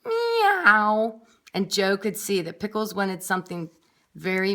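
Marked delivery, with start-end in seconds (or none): 0.1-1.2 high, louder spoken meow; cat meow. A narrator's reading. Pickles answering Joe with a cat meow. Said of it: "said one word".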